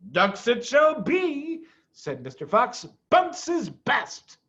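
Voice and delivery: woo his wife playfully; charming Mr Fox. Acted. Mr Fox replies.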